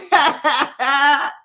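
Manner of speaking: bright laugh. Real, not acted. Calling the weed her best friend, laughing. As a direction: laugh at your own joke.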